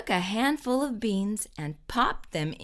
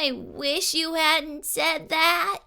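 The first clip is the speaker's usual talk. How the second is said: groaning; 7 semitones higher, slower than usual. A narrator's reading. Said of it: "Camilla groaned".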